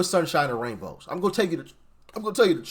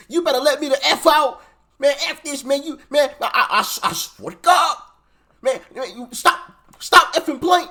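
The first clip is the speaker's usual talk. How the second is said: imitating cursing kid; 7 semitones higher, 4 dB louder than usual. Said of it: "cursing at the store employee".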